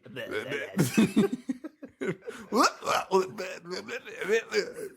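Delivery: high, mock possessed babble; obnoxious babbling. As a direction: gibber nonsense as the weird mom. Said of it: "it was literally like".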